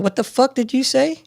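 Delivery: high-pitched incredulous demand; mock confrontational, pitch jumping up; retold indignant challenge. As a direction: call him out, retelling it. Retold challenge to muttering chief.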